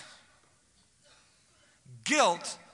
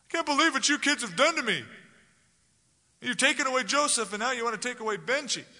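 higher, louder whiny complaint to his sons; a hint of Jacob wallowing in self-pity; self-pitying father; 9 semitones higher, 4 dB louder than usual. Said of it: "He's so worried about himself".

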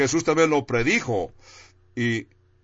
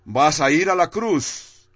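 higher-pitched, mock last-minute interruption; mock sudden call-out; 4 semitones higher than usual. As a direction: imitate God suddenly calling someone back. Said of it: "de repente le grita y le dice".

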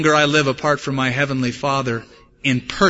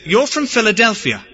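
put-on higher-pitched shop owner naming the accent; shop owner's guess; 6 semitones higher than usual. Shop owner placing a customer by accent, higher-pitched imitation. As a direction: pitch it up as the confident accent-spotter. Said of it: "And the guy said".

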